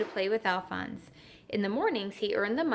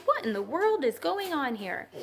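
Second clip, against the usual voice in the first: mild cry; 6 semitones higher than usual. A narrator's reading. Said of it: "they cried".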